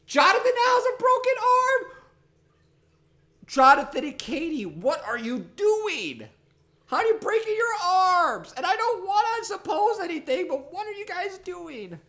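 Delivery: very high, loud squeaky shouting; high shouting nag. Pretend. Demanding why Jonathan and Katie keep breaking arms, high shout. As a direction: shriek at the clumsy family.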